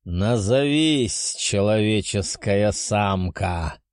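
slow commanding dragon voice; drake. Acted. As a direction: demand it like a haughty beast. Dragon demands her name.

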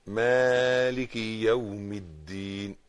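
مالك with the kasra said as one short beat; careful recitation. Shown as a demonstration. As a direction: keep the kasra in مالك brief. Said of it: "كسرة بس".

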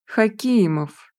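slow, stretched-out call of the name; drawn out. A narrator's reading. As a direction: draw the name out slowly. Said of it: "тянет Степан". (протяжно, нараспев)

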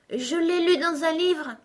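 put-on high, innocent little-girl voice; gentle Alice. A narrator's reading. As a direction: explain sweetly, pitched right up. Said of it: "dit Alice d'une voix douce". (petite voix douce et aiguë)

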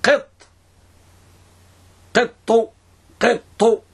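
"قط" pronounced as a separate demonstration word; ضبط الطاء في "قط". Shown as a demonstration. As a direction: say "قط" in isolation, showing the ending on the ط. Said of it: "بسكون الطاء وكسرها". (نطق "قط" منفردة لبيان ضبط الطاء)

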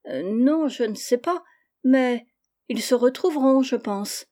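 put-on unsure little girl, fading on the last words; hesitant. A narrator's reading. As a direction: answer hesitantly, caught out. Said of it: "répondit avec hésitation".

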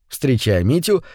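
usual speaking voice

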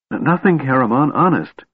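high, stammering, breathless denial; gasping. A narrator's reading. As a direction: gasp it out, startled and scared. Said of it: "Tass gasped".

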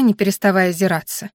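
plain reading voice

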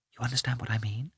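soft conspiratorial whisper. A narrator's reading. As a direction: whisper it so the cook can't follow. Kitty hints secretly to mother.

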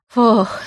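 loud "phew" of relief; relieved exhale. A narrator's reading. Older brother learns the treat is for the little one, relieved exhale. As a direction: breathe out as the worry lifts. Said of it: "облегчённо выдохнул он".

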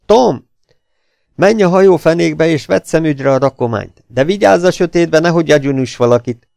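magasan, hangosan odaszól az emberének; odakiáltás. A narrator's reading. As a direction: parancsot kiált le a hajófenékbe. Gangster calls his man. (hangos, magas parancsoló kiáltás)

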